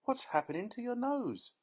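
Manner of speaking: very high, panicked question; shrill alarmed mother. Acted.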